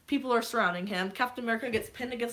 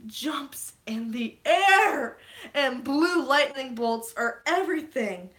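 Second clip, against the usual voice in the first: awed hype; 4 semitones higher, 5 dB louder, slower than usual. Real, not acted. Hyped retelling of the scene.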